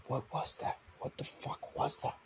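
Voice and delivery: breathless whispered alarm; hushed whisper. Acted. Mark whispering after hearing the body drop. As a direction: whisper it, scared of being heard.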